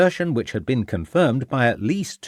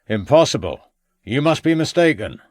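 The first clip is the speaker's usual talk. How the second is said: called out; 5 semitones higher, 4 dB louder than usual. A narrator's reading. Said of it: "called out the old man".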